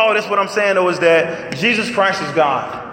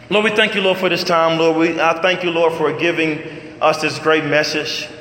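closing prayer; 4 semitones lower than usual. Acted. Pastor closing the sermon in prayer, hushed. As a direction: pray humbly to close.

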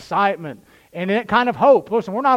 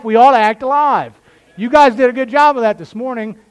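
raised, higher-pitched pulpit voice; fired-up preaching; 3 semitones higher, 5 dB louder than usual. Real, not acted. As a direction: drive it home to the congregation.

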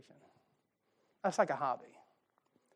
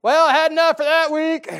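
smug stuffed churchgoer; 11 semitones higher, 15 dB louder than usual. Retold or imitated. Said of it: "we go home".

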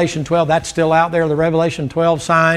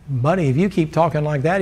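ordinary talk, nothing marked

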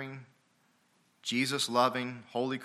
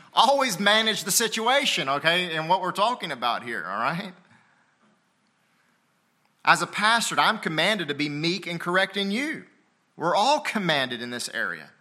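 loud, raised preacher's delivery; forceful preaching; 7 semitones higher, 6 dB louder than usual. Real, not acted. Pastor instructing the church on correction, forceful preaching. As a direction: drive the point home with volume.

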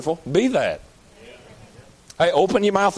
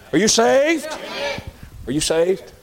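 mock eager question; 7 semitones higher than usual. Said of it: "mimicking someone asking".

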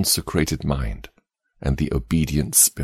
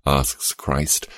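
ordinary delivery

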